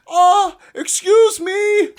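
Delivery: loud, high, drawn-out mock whine; interrupting kid. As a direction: squeal to butt in on the grown-ups.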